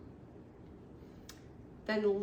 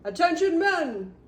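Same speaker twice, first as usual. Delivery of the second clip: loud, high army order; rally the troops at full volume; barked command; 8 semitones higher, 10 dB louder than usual. A narrator's reading. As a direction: shout it like a drill colonel. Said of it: "the colonel said".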